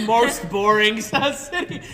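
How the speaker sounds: loud laugh through the words. Real, not acted. Teasing friend's hometown, laughing loudly. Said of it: "laughing at the label".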